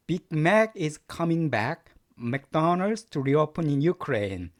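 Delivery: slow, word by word English read-aloud; teacher reading a headline slowly to students; slow careful reading. Shown as a demonstration. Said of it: "전체 한번 들어보실까요".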